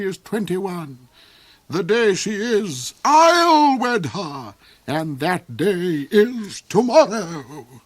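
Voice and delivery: high, loud, cold villain rhapsodizing; the Duke's high voice. Acted. The Duke raves to Hark about Saralinda, high and loud. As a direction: soar up high and gloat over her.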